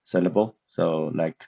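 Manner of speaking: ordinary talk, nothing marked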